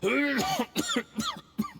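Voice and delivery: sudden high strangled cough; a poisoned man choking on his wine. Acted.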